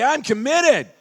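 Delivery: loud committed declaration; call and response. Shown as a demonstration. Motivational speaker leading the crowd, loud. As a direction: proclaim it to the room. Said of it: "Look at your neighbor and say".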